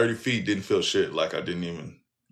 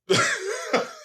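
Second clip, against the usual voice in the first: high laugh. Real, not acted. Laughing at mama's explanation.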